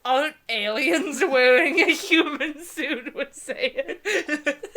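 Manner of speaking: amused mockery; laughing. Real, not acted. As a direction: giggle out the joke. Mocking the book's phrasing, laughing.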